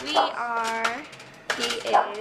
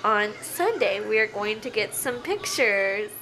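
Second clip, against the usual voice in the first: ordinary talk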